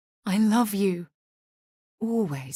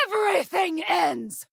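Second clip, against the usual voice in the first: fierce shout; 12 semitones higher than usual. Acted. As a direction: scream it like a zealot in battle. Fanatic battle cry.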